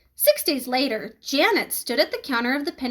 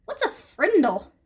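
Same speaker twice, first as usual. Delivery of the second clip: puzzled; 5 semitones higher than usual. A narrator's reading. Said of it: "She wrinkled her nose and said".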